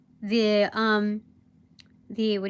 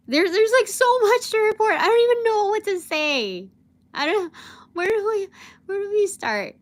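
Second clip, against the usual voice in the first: high, loud, exasperated rush of words; can't believe how much there is to tell; overwhelmed excitement; 10 semitones higher, 6 dB louder than usual. Real, not acted. Venting about too much to report.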